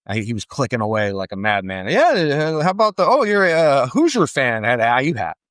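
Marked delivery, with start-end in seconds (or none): 1.9-4.6 lightly voiced awkward doctor, higher; fumbling, forced small talk. Doctor nervously chatting up the dad, a lightly higher awkward voice.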